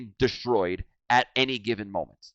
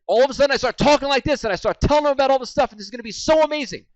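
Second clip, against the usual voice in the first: high, loud, racing hype; really excited; 12 semitones higher, 5 dB louder, much faster than usual. Shown as a demonstration. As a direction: burst into fast, gushing excitement. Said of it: "where I get really excited".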